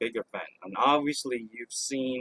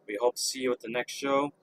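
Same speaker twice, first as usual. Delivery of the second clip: ordinary delivery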